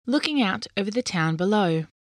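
plain reading voice

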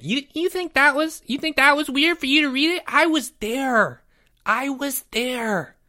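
put-on very high-pitched boy voice; indignant boy character. Jeffrey objecting to the host's comment, indignant high voice. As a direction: protest in a high voice, slowing at the end.

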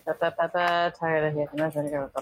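ordinary delivery

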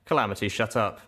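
ordinary delivery

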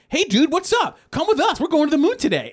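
high, loud cartoon buddy; Finn and Jake. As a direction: shout a gung-ho cartoon invitation. Acting Finn and Jake's cartoon invitation.

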